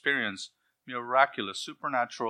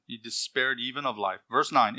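ordinary talk, nothing marked

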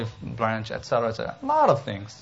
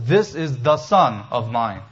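slow and deliberate, with weight on "This" and "the"; emphatic stress; slower than usual. Shown as a demonstration. Preacher giving the literal Greek, slow emphatic stress on "the Son". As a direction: punch "This" and "the Son", word by word. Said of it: "The Greek is very emphatic".

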